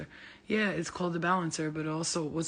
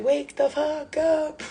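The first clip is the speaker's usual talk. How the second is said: high, slow, loud yell; wild yell; 11 semitones higher, 8 dB louder, much slower than usual. Retold or imitated. Yelling at sleeping people to wake up. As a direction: scream them awake. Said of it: "I'm like".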